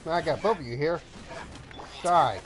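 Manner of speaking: hammy battle taunt; taunting shout. Real, not acted. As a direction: taunt them, then yell the last word. Player taunting enemies in combat.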